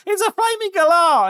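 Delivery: high, loud mock-excited cry; hammed-up squeal of discovery; excited exclamation. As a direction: call it out in amazement. Retelling excited sighting to friend.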